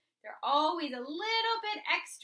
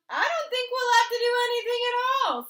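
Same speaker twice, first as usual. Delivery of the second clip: nervous high rush; 11 semitones higher, 11 dB louder, faster than usual. A narrator's reading. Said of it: "said Kristen".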